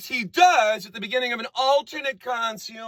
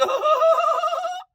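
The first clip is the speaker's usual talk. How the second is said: high shouted mock laugh; imitating a singer's absurd laugh; ridiculous mock laugh. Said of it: "He's like".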